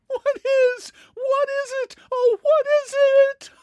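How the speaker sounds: high shrill frightened cry; terrified girl screeching at the cup; screeching fright. A narrator's reading. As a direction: screech it out in hysterics. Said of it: "She sort of screeches out".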